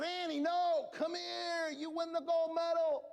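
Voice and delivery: high calling coach. Retold or imitated. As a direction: call her back to the finish line. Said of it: "And I said".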